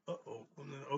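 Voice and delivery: wincing through a brain freeze; pained by a sudden brain freeze. Real, not acted. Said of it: "Ooh, brain freeze".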